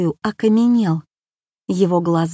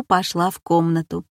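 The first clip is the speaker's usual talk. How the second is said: ordinary delivery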